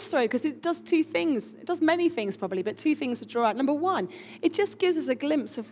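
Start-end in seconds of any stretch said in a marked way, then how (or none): none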